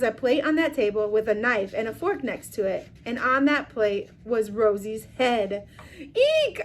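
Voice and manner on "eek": tiny high squeak of fright. A narrator's reading. Hamster squeaking in fright at the head. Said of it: "I squeaked".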